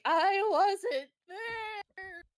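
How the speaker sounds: sobbing apology to his partner; weeping uncontrollably. Acted. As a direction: blubber the apology through tears. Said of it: "weeping, like, uncontrollably".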